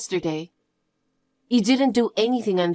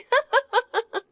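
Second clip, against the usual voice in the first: mocking laugh; 13 semitones higher than usual. Sister mocks dying father.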